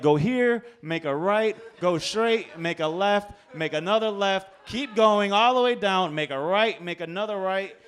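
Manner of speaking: loud, punched turn-by-turn orders; insistent directions. Retold or imitated. Drunk passenger directing the cop, loudly insistent. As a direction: call out each turn firmly.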